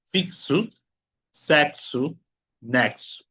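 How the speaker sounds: "Fixo, sexo, nexo" as "fiksu, seksu, neksu". Shown as a demonstration. Teacher showing word-final pattern, "Fixo, sexo, nexo" with ks. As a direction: say each of "Fixo, sexo, nexo" separately, crisp ks. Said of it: "El cuarto sonido es el sonido x".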